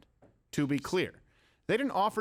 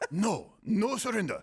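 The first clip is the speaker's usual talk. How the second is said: mock prime minister; 6 semitones higher, slower than usual. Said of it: "the Japanese prime minister who said".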